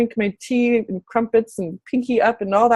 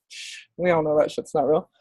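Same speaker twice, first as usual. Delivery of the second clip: low, soft conspiratorial aside; hushed aside; 7 semitones lower, 6 dB quieter than usual. Real, not acted. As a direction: let them in on a secret, hushed.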